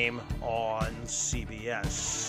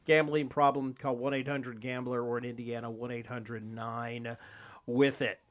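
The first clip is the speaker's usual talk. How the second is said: rushed, quieter fine print; fast legal disclaimer; 6 dB quieter than usual. Acted. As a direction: rattle off the legal small print.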